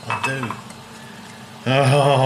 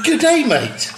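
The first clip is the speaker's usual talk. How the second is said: high squeaky Australian "Good day, mate", louder; a hammed-up Aussie greeting, much higher; mock Australian accent; 4 dB louder, much slower than usual. Mocking Australian accent, teasing the Kiwi colleague. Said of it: "Good day's Australian".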